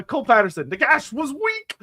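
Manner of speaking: ordinary delivery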